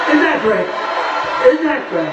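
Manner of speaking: drawn out, slow mock delight; sarcastic. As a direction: stretch it out, dripping with irony. Mocking teleprompter speeches.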